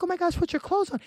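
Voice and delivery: high-pitched mock panic; freaking out. Date to naked man on balcony, mock panic. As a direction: squeal the plea in retold alarm. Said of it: "I know I'm freaking out".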